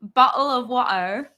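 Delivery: bottle of water in a try at British; badly faked British accent; attempted British accent. Shown as a demonstration. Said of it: "Okay, British. The only thing I can try".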